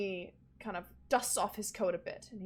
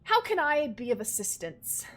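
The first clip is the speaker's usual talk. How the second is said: loud, raised courteous greeting; lightly voiced formal manservant; polite butler; 4 semitones higher, 8 dB louder than usual. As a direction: welcome the guests grandly. Clarence greets the players.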